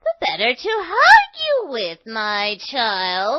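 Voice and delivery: high, slow, put-on granny voice; wolf faking granny. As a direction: lure her in sweetly.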